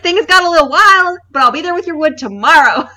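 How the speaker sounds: high, loud mock Kansas City accent; thick Kansas City accent. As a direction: imitate the excuse-making guy in a thick Kansas City accent. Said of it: "the thickest accent".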